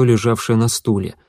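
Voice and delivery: plain reading voice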